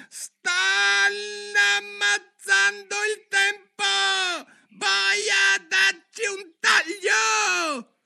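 very high, slow furious shout; queen shouting. Acted. Queen of Hearts screaming at the festival, very high and slow. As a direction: bellow like a raging queen. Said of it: "gridò con voce sincopata".

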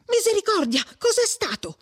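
high-pitched startled exclamation; alarmed cry. A narrator's reading. Perpetua hearing the scream from the house, alarmed cry. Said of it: "gridò Perpetua".

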